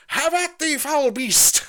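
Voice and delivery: very high, loud mock knight cry; stagey fake archaic declaiming. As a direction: ham up a knight's battle cry. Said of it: "going around all night like".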